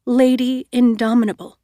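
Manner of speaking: plain reading voice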